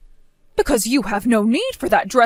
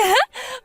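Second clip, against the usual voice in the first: mocking laugh; 11 semitones higher than usual. Mocking the ruined bride.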